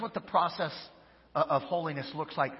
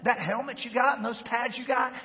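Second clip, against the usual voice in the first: pep talk; 6 semitones higher, 5 dB louder than usual. Retold or imitated. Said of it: "I'm like".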